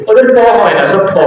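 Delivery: ordinary talk, nothing marked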